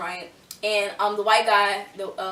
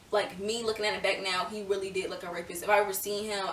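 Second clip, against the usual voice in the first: ordinary talk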